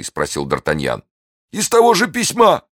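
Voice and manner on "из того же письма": high, strained voice of a wounded bandit; frightened bandit. Acted. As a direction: plead in a thin high voice.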